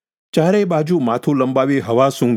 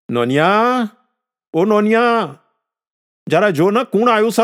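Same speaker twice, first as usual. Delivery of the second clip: put-on high, stretched-out call to the boy; 9 semitones higher than usual. A narrator's reading. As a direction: summon the child to check who has come. Grandfather calls the boy to look. (છોકરાને ઊંચા સૂરે સાદ પાડવો)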